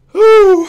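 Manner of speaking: a sharp exhale to calm down; high loud whew. Real, not acted. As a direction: blow out the excitement, high and loud. Trying to calm down.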